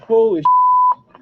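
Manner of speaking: long high panicked squeal; shrieked holy. Real, not acted. Gamer shrieking as the cat comes back. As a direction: stretch it out in a shriek.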